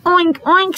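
high, squeaky oink-oink; playful high pig noises. Shown as a demonstration. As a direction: snort it out like a little pig. Pig voiced in story.

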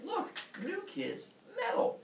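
high, slow luring call; coaxing father. Acted. Father luring kids with metal.

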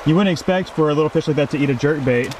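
ordinary delivery

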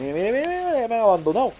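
high-pitched, loud mock outrage; high rapid plaintive cry; playful alarm. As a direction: wail it up high at being left behind. Mock panic at being abandoned by teammates.

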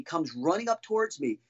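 normal talking voice

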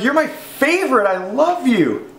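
high, gushing put-on woman's voice that slows down at the end; imitating the woman. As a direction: gush it like a tipsy fan. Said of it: "she was like".

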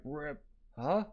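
ordinary delivery

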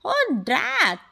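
dad's exclamation, lightly hammed-up; storybook yell. A narrator's reading. Dad reacts to the possum, mild mock yell. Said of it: "yelled Dad".

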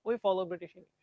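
ordinary talk

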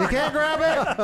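high laughing sing-song; laughing taunt. Acted. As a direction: tease it through laughter. Taunting repeat while laughing.

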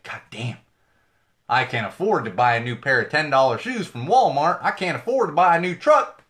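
loud, hammed-up Southern complaint, pitched high; badly faked Southern yell, building as it goes; bad Southern accent. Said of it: "Can't do a Southern accent".

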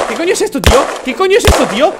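panicked yell. Real, not acted. Mannequin attack.

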